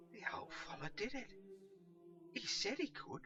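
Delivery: high, loud gleeful laughing relief; overjoyed his hay fever is gone; delighted wonder. Acted. Pickle rejoices over his cured hay fever, high gleeful laughter.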